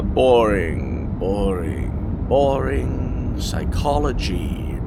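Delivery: low, drawn-out bored reading; bored dismissive. Acted. Reading a patient's files aloud, bored droning. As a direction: drone it like the pages put you to sleep.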